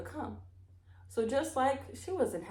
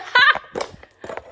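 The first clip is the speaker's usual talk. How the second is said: high unvoiced burst of laughter; breathless laugh; 11 dB louder than usual. Real, not acted. Reader laughing after the camera falls, breathless. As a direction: laugh out in surprise.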